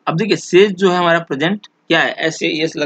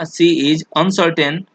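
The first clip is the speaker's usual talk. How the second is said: dictation pace; much slower than usual. Shown as a demonstration. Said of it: "क्योंकि is यहाँ पे पहले ही".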